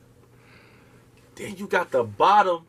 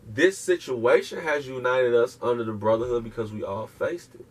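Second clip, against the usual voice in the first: ordinary delivery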